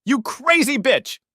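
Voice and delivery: high, loud, slow insult; man yelling at a woman fleeing the room. Acted. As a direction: bellow it after her. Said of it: "he yelled".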